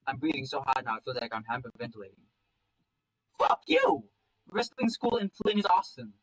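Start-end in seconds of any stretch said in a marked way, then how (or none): 3.4-6.1 very high, loud outburst; acted furious shout, pitch leaping then easing; defiant yell. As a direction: explode at a parent in defiance. Child swears at Dad in quarrel.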